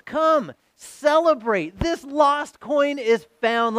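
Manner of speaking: high, drawn-out joyful call; yelling to neighbours. Acted. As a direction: call the neighbours over to celebrate, slowly. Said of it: "she yells out to her neighbors".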